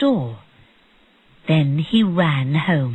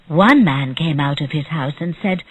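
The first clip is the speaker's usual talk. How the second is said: plain reading voice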